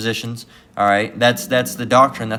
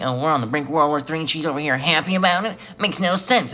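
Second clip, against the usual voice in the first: higher, quicker, mock-baffled whine; 5 semitones higher than usual. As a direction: ham up a baffled critic, pitch rising to the end. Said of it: "having a talk like this".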